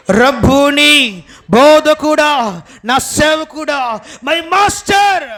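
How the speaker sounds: loud, very slow, drawn-out cry of recognition; a loud, slow, high cry of Rabboni; strong cry. Retold or imitated. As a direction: cry out to the master, stretching each word. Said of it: "ఒక బలమైన కేక వేసిందంట".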